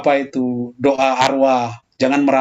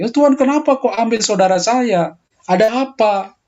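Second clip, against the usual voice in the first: raised, crying-out question to God; pleading lament; 8 semitones higher than usual. Retold or imitated. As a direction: plead with God, voice lifted high. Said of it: "ratapan itu doa yang tulus". (ratapan memohon kepada Tuhan, nada tinggi)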